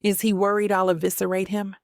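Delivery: ordinary delivery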